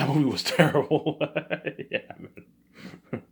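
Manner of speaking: can't help cracking up at how bad it was; laughing. Real, not acted. Fan laughing off the terrible sequel, laughing through it.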